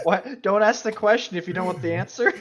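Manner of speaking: laughing. Real, not acted. Joking with friend about Ron, laughing while talking. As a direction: giggle the retort out.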